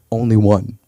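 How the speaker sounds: low, flat-voiced mom answer; mother's deadpan. Mother telling him she'd only want one Eric, low deadpan. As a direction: drop the voice low and final. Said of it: "She'd be like".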